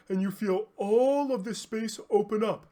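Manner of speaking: yawning. Shown as a demonstration. Teacher still yawning while explaining the open throat. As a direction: speak through a yawn. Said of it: "if you yawn with me".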